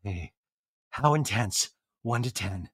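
hushed, soft aside; low, intimate check-in; leaning in close. Retold or imitated. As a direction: imitate someone murmuring a private question. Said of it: "she leans down next to him".

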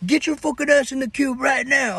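high, loud barked command; guard order. Retold or imitated. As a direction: order the inmates to their cubes. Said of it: "They was like".

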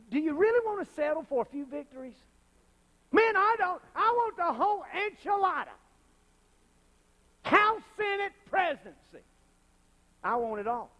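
high, loud, hungry for it all; rallying cry. Real, not acted. Preacher rallying the congregation, high and loud. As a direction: fire up the crowd.

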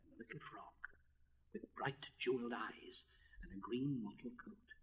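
hushed, breathless whisper; whispered narration. A narrator's reading. Storyteller whispering the frog's entrance to listeners.

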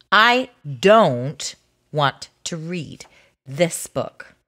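slow, low, spaced-out words in "I don't want to read this book"; deliberate refusal. Acted. Title repeated as the reluctant reader's protest.

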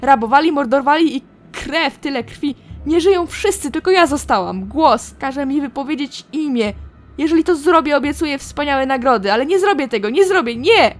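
loud, tormented pleading that breaks into a yell; a woman driven mad by a voice in her head; frantic madwoman. Acted. Karsa begs the voice to stop, frantic and loud.

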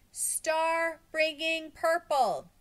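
slow, high, drawn-out shout; crowd shouting. A narrator's reading. Kids and teachers shout up from below, slow loud shouting. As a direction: holler it up from far below. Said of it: "were shouting together".

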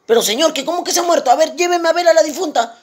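high, quick, incredulous demand; startled insistence. Acted. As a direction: press urgently in a high voice. Doctor sorprendido exige ver la difunta. (sorprendido e insistente, en tono agudo)

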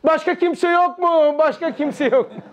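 hammed-up holler breaking into a laugh at the end; mock shouted plea. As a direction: call up for someone else, comically desperate. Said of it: "O aşağıdaki bağırmış".

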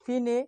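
ফিনে as two syllables, "fi-ne", final e sounded; mistaken reading. Shown as a demonstration. Teacher showing what learners might say without rules, ফিনে with sounded e. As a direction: misread fine as ফিনে, "fee-nay". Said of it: "কোনটা সঠিক?".

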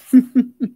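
loud burst of real laughter. Real, not acted. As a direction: break out laughing at the story. Reader laughs at the scene.

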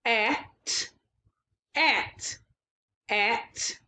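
repeating at; blending sounds. Shown as a demonstration. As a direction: say the blend at each time. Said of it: "it's a two sound word".

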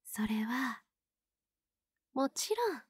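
soft hushed whisper. Acted. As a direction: lean in and whisper it. Building to a confession.

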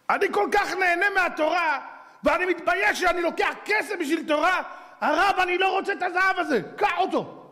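very high, impassioned acted wail; hammed-up anguished plea; tearful pleading. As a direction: beg as if crying, pitch held high. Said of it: "והוא בוכה".

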